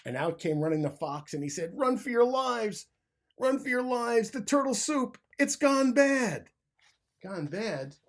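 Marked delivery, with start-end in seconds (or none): 1.8-5.2 high, loud frantic yell; panicked shout. A narrator's reading. Fleeing fox yells a high, frantic warning to everyone. 5.4-6.5 high, very loud, slowed wail on gone bad; panicked slow shout. A narrator's reading. Fleeing fox wails high and loud that the soup went bad.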